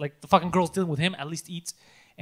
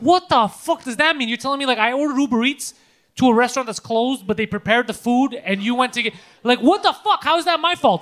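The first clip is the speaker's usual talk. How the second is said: raised, indignant phone rant; retold angry yelling; 3 semitones higher than usual. Retelling a phone fight with the delivery guy, angry yelling. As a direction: argue in exasperated disbelief, pitched up. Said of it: "get me to yell on the fucking phone".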